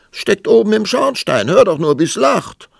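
very high, loud exclamation; exasperated outburst. A narrator's reading. King complaining about child in chimney, loudly exasperated. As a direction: throw it out at full pitch and volume.

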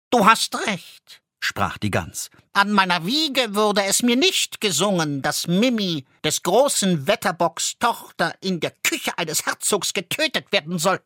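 put-on high, lilting goose girl. A narrator's reading. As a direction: speak up high as the enchanted goose. Goose character speaks.

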